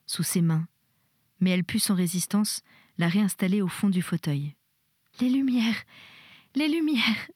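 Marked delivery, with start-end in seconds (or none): 5.2-7.3 high, distressed cry; sobbing plea. A narrator's reading. Terrified young woman begs her nurse for light, acted sobbing cry. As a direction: plead in panic, pitch climbing on the repeat. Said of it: "sanglotait ma belle".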